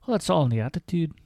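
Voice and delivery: put-on brisk amateur Scottish accent, oo of "attitude" stretched; quick Scottish brush-off, leaning on the oo in "attitude"; Diana's thick Scottish. Diana lecturing her guest about attitude, in a thick Scottish accent. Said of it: "her accent thickening the oo of the last word".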